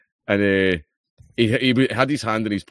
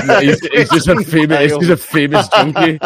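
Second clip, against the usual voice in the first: laughing; 7 semitones higher, 8 dB louder than usual. Real, not acted.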